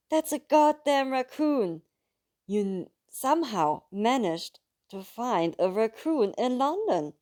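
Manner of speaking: loud, high, slow exasperated cry; disbelieving outburst. A narrator's reading. Narrator telling Luca his pet is a raccoon, disbelieving outburst. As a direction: shout it in disbelief.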